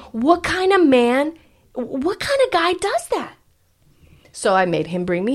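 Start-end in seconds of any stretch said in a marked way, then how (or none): 0.1-3.3 indignant outrage. Retold or imitated. Retelling the outrage over the date and his silk shirt, indignant. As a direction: imitate a scandalized mother, pitched up. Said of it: "my mom was so fucking pissed".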